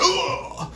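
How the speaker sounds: high pained grunt from a hit. Retold or imitated. Friend being hit by police: high grunt. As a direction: yelp as if struck. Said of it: "I just hear sound effects".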